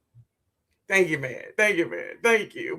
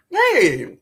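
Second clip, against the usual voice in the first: normal talking voice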